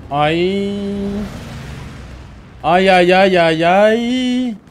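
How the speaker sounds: high, loud stretched ay cries; excited wail. Real, not acted. Streamer wailing at a close fight. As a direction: wail in suspense as it goes wrong. Reacting to tense moment.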